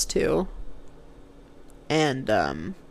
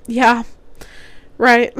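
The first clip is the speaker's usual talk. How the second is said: whimpered puppy voice; 5 semitones higher than usual. Retold or imitated. Sick girlfriend weakly joins the family's chat, puppy-like whimper. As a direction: sound like a hurt puppy trying to join in. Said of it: "my whimpered, poor, injured puppy voice".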